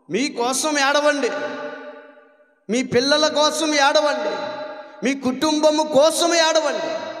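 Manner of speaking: rising preacher's call; fervent preaching cry. Acted. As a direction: cry it out to the congregation. Preacher voicing Jesus, rising fervor.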